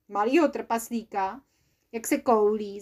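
plain reading voice

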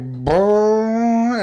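a high buzzing engine brr; imitated engine rumble. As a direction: make the car sound with your lips. Mimics car engine noise.

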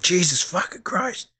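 half-whispered shout, slow; whisper yelling. Retold or imitated. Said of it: "half whispered it, like the whisper shout".

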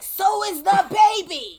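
loud, high, drawn-out mock shout; imitated yelling. Passenger's comeback to the flight attendant, retold as a slow high yell. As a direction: yell it back like an outraged passenger. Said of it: "Yes, but you're yelling".

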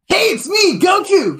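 high, cheery cartoon hero voice; squeaky upbeat Goku; Goku impression. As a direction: pitch way up like a chirpy anime hero. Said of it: "you just hear, like".

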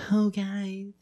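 soft whiny mock plea; whiny quarterback. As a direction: whimper like a protected QB. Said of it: "the quarterback's like".

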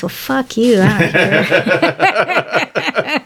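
stretched out and breaking into laughter; play-rude, laughing through it; laughing mock insult. Teasing the Dallas crowd back, laughing mock insult.

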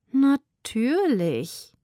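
höher und sehr langsam geschnurrt; purred. Acted. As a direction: zieh das Wort genüsslich wie eine Katze. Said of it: "schnurrte sie". (langsam, höher geschnurrt)

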